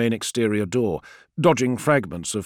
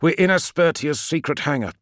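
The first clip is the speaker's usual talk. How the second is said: raised, eager announcement; excited; 6 semitones higher, 4 dB louder than usual. A narrator's reading. Call reveals the secret hangar to Friedish, excited. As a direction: lift it with excitement. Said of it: "said Call excitedly".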